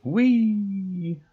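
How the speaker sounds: high gleeful squeal; gleeful whee. Real, not acted. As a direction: let out a happy high whee. Gleeful cry alone.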